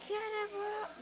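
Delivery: loud excited shout; cannonball yell. Real, not acted. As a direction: yell it as you jump. Yells before jumping in.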